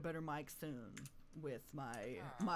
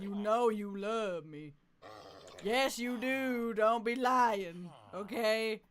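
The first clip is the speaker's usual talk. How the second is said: slow, louder drawn-out teasing; sing-song teasing; 4 semitones higher, 7 dB louder, much slower than usual. Pretend. Streamer to her horse, slow mock-stern teasing.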